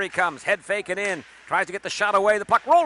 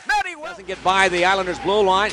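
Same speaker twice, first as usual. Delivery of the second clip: usual speaking voice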